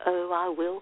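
ordinary delivery

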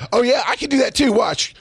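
high-pitched sarcastic comeback; mock taunt. Retells taunting the clerk back.

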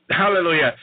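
ordinary talk, nothing marked

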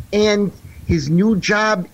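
normal talking voice

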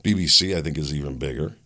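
usual speaking voice

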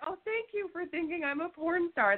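mock gratitude. Guest retelling reply to being taken for porn star, mock gratitude. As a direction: gush thanks as a joke. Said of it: "I was like".

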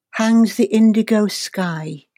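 ordinary delivery

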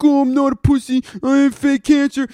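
high-pitched mocking whine; Roman Reigns. Ranting fan mimics the wrestler protesting, high mocking whine. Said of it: "Roman Reigns is just like".

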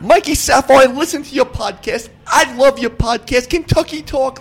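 hyped broadcaster impression, high, loud and sing-song; Dick Vitale. Famous guest praising the podcaster, full-blown hyped shout. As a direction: belt it out in full character. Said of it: "Dickie V mode".

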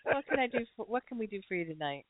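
ordinary talk, nothing marked

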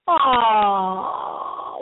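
normal talking voice

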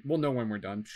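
normal talking voice

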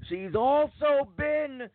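high, drawn-out long-winded introduction; Gilbert Gottfried. Retold or imitated. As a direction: drone on in a slow, high mock voice. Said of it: "he's going on and on and on".

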